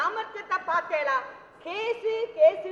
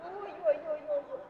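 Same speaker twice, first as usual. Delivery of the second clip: doting exclamation; 3 semitones higher, 6 dB quieter than usual. Acted.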